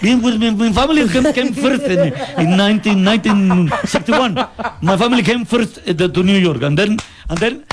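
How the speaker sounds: mock Pakistani accent in English, laughing through it; Pakistani taxi driver. Host imitating a Pakistani cabbie asked where he is from, mock accent. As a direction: play Ibrahim the cabbie, proud and chatty, accented. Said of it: "where are you from, Ibrahim?".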